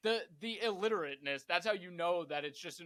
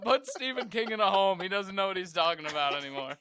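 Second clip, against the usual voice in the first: laughing rant; 4 semitones higher, 9 dB louder than usual. Real, not acted. Ranting about Stephen King, laughing.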